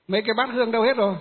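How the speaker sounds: monk startled at an empty altar, asking fast; surprised question. Retold or imitated. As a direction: blurt it out, caught off guard. Teacher notices the altar is bare.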